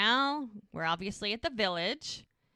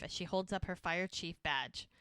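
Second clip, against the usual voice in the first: ordinary delivery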